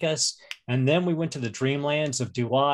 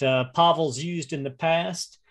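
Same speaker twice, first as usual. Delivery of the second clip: usual speaking voice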